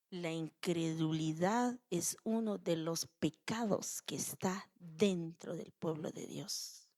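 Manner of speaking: quiet solemn warning to the congregation; hushed warning. Real, not acted. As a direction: drop to a grave hush. Soft serious warning.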